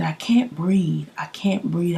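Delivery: soft unvoiced panicked whisper; breathless whisper. Telling Shay she can't breathe, in a panicked whisper. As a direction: whisper like you can't get air. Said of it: "all I could say to Shay was".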